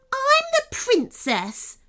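very high, drawn-out princess shout; imperious royal yell; sudden shout. A narrator's reading. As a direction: proclaim it in a spoilt outburst. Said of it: "shouted the princess suddenly".

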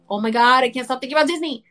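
fast, loud, exasperated rush; exasperated outburst. Retold or imitated. Retelling her trip to a friend, a loud, fast exasperated outburst. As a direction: blurt it out fast and loud, overwhelmed. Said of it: "And I'm like".

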